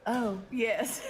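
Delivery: ordinary talk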